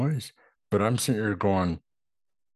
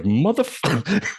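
drawn-out high exasperated curse; 9 semitones higher, 5 dB louder, slower than usual. Retold or imitated. Viewer curses the character, drawn-out and high. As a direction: groan it out at the screen.

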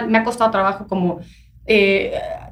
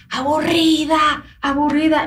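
drawn-out, higher mock yell without real volume; 5 semitones higher, slower than usual. Tipsy friend calling from his door, mock yelling. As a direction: imitate a heckler calling out, raised pitch. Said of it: "me empezó a gritar, a gritar así desde la puerta".

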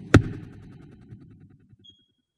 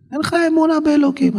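rebuke; faster than usual. Real, not acted. Preacher rebukes complainer.

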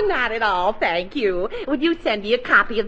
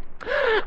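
sharp gasp; 9 semitones higher, 6 dB quieter than usual. Acted. On hold, waiting on phone.

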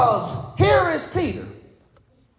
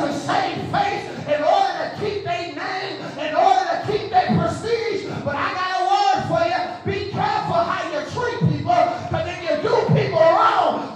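preaching at full force; 4 semitones higher than usual. Acted. Pastor pressing the Broadway congregation, in full preaching force. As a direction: drive every line home like a revival preacher.

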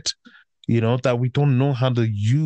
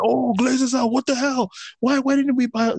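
much higher, whiny mock-fan voice; 12 semitones higher than usual. Ranting fan mocks complaining supporters in a high whiny voice. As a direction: parody the fans moaning "Glazers out". Mocking fans who complain.

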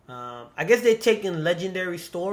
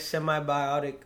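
ordinary delivery

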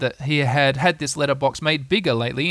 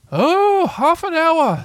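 much higher, louder owl voice; pompous Owl; 14 semitones higher, 6 dB louder, much slower than usual. Acted. Owl settling down, high and pompous. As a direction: sound grandly pleased with yourself.

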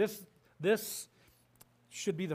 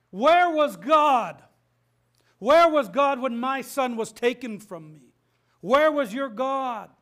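much higher and louder, drawn-out anguished demand; angry grieving shout; 8 semitones higher, 13 dB louder, slower than usual. Retold or imitated. Grieving woman confronting the pastor over her son, anguished angry shout. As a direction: hurl it at the pastor like a wounded mother. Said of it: "kind of angrily and upset and says".

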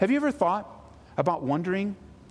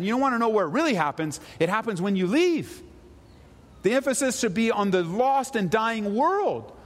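higher, urgent sermon push; impassioned preaching; 7 semitones higher than usual. Acted. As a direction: drive the point home to the congregation. Pastor preaching full force.

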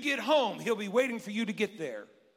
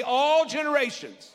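higher, louder repeated call; call and response; 8 semitones higher, 8 dB louder than usual. Shown as a demonstration. Pastor leading congregation response, loud call. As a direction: lead the church to shout it back. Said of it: "Somebody say".

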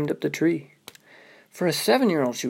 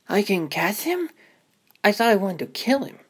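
put-on higher, eager little-girl voice; 7 semitones higher than usual. Little sister Ruby asking her brother, high and eager. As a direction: play the excited small sister.

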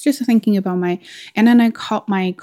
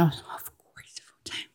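whisper; 14 dB quieter, slower than usual. Retold or imitated. Eager answer retold quietly.

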